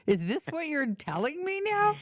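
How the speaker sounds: high-pitched incredulous scolding; hammed-up indignation; mock outrage. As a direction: demand an answer in exaggerated disbelief. Mock scolding over the cold house.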